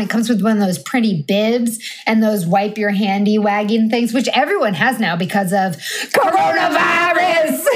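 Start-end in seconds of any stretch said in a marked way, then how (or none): 6.1-7.6 comic shouted announcement; hammed-up shout; mock-dramatic shout. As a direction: yell it with exaggerated drama.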